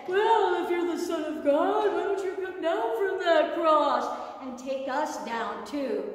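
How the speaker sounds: mocking criminal on the cross; insulting jeer. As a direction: hurl it at him like an insult. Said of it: "hurled insults to Jesus".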